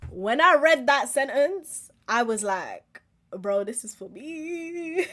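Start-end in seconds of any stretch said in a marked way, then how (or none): 3.3-5.1 drawn-out realization. Retold or imitated. Quoting own reaction to verse.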